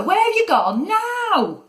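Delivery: very high exasperated call; high-pitched shouted question; shouting. A narrator's reading. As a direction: call out high, voice soaring at the end. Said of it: "he shouted".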